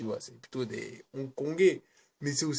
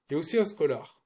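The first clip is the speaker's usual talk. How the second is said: ordinary delivery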